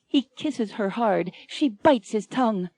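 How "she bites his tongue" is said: ordinary delivery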